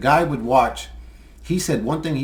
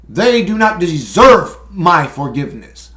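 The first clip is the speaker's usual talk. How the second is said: loud defiant declaration; 4 semitones higher, 10 dB louder than usual. Real, not acted. Angry refusal to forgive.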